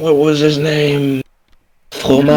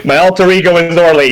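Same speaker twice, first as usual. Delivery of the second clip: playful character impression; 3 semitones higher than usual. Player showing off an alter ego, playful impression. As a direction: rattle it off in a put-on voice. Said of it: "that's not a bad impression".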